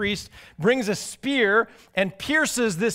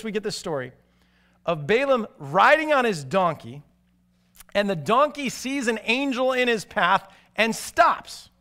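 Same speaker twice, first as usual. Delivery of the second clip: ordinary talk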